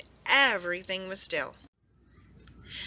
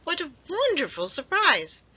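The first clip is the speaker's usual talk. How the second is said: put-on higher, delighted old squirrel; a grandpa pleased by visitors; lightly voiced grandpa; 8 semitones higher, 4 dB louder than usual. A narrator's reading. Grandpa Squirrel welcoming the friends, delighted. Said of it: "declared Grandpa Squirrel".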